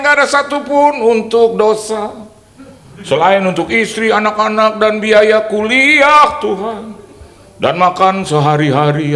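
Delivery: high and loud, crying out to God; a preacher shouting his prayer for help; loud pleading prayer. Retold or imitated.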